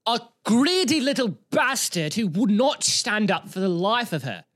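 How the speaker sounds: high, slow theatrical denunciation; weird put-on voice. Vlogger condemning the stubborn bridesmaid, weird put-on voice. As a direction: denounce her slowly in an odd high voice. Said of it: "That was weird".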